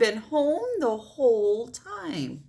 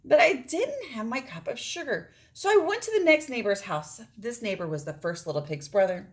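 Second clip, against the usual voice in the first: ordinary delivery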